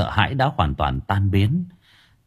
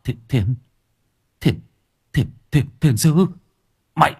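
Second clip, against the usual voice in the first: stammer, rising higher into a frightened question; 6 semitones higher than usual. Acted. Possessed man recognizing the monk, stammering in fear. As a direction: stutter in fear, climbing up. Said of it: "miệng lắp bắp".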